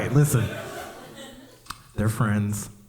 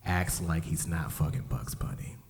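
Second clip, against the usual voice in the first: soft whispered reveal; quiet conspiratorial reveal; hushed punchline; 5 semitones lower, 9 dB quieter than usual. Real, not acted. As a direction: drop it to a whisper.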